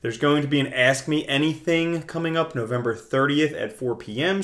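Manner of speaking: ordinary talk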